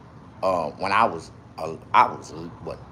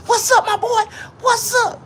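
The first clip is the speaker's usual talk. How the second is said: squeaky voice; 4 dB louder than usual. Acted. High squeaky greeting voice.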